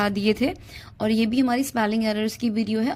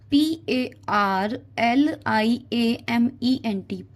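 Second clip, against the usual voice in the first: slow spelling out; much slower than usual. Shown as a demonstration. Teacher to SSC students, spelling out each letter slowly. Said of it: "words को अगर आप break कर करके लिखो".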